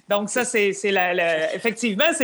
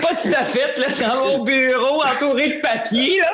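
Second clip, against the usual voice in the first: laughing; 4 semitones higher, 3 dB louder than usual. Real, not acted. Amused reply to running joke.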